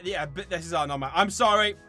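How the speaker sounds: ordinary talk, nothing marked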